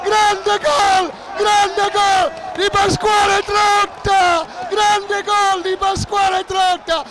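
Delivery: breathless italian goal commentary. Real, not acted. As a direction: scream the goal call, then ride it down. Goal scored, commentator erupts.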